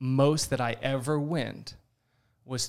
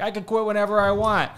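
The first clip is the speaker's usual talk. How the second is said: higher, louder, cocky addict voice; mock addict denial; 8 semitones higher, 8 dB louder than usual. Mocking how every addict claims control, cocky denial. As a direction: play the brushing-off addict. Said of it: "Like every addict, you're like".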